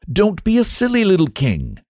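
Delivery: high-pitched, fussy reprimand; exasperated high scold. Acted. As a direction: tell off a silly child. Chancellor scolds the boy king.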